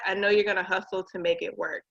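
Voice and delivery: ordinary talk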